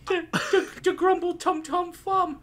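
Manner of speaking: put-on high, nervous little kid; small child voice. Child answers the glowing-eyed psychic, high and timid. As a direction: squeak it out like a scared child.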